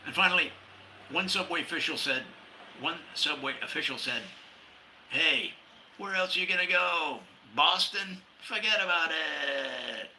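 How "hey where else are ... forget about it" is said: put-on New York accent. Subway official, New York tone.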